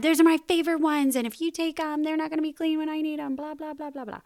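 put-on high, fast angry kid trailing into blah blah; mad child. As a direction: rattle it off like a cross child, fading out. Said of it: "just gets mad".

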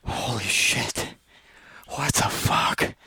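slow, whispered disbelief; stunned hush. Retold or imitated. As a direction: breathe it out in shock. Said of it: "we're just like".

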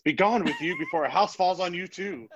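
high, mock-imperious proclamation; theatrical banishment. Peter dismisses a complaining passenger with a wand, theatrically imperious. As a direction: banish them with a wand, hammed-up. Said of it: "he comes walking and he says".

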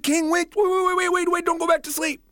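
high, loud urgent plea; pleading. Acted. Boy pleads with the king, high and loud. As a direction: beg him not to sleep.

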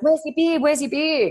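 high, loud teasing repeat; playful teasing. Retold or imitated. Client teasing her husband at the hose, playful. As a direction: tease him about the missing beer. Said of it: "she's like".

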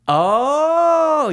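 long, high-pitched "oh"; dawning realization. Real, not acted. Friend finally works out who is meant, long high realization. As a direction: draw it out high as it clicks.

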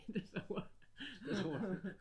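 laughing. Real, not acted. Laughing repeat.

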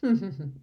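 short derisive snigger; brief mocking laugh. A narrator's reading. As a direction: snigger scornfully through closed lips. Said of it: "and sniggered".

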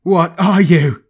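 high, loud gasp of terror; terrified gasp. A narrator's reading. Gang leader gasps at the shapeshifting assassin, shaking with terror. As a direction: cry it out in sudden terror. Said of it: "his voice shaking with terror".

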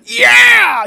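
very high, full-volume "Yeah!"; triumphant mock yell. Co-host imitating Silver as the boards smash, triumphant yell. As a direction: roar it in hammed-up triumph.

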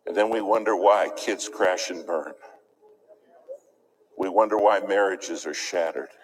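ordinary talk

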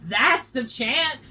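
loud, high movie character voice; quoting the movie. Retold or imitated. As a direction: ham up the character's line. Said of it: "And then he's like".

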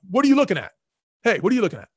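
mock yell at a stranger; retold yell. Guy on porch challenges a passerby, mock yell. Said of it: "one of the guys yells at me".

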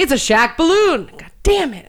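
high, loud, drawn-out outburst; loud exasperated shout. Retold or imitated. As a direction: blow up at the mirror in frustration. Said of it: "you're like".

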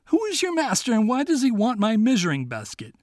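king demanding who the master is. Acted. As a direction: play a bewildered old king. King questions the fox.

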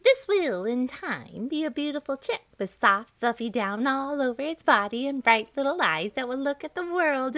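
high, bright hen voice cooing over her eggs; proud mother hen. Acted. Speckled hen to herself about her egg, high hen voice. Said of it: "she would cackle to herself, saying".

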